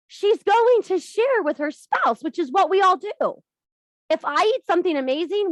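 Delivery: excited. Real, not acted. Excited build about sharing.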